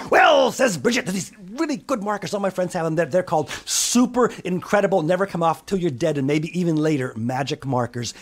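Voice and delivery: high, eager sales pitch; excited child. Acted. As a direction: sell mum on the markers. Bridget pitches magic markers.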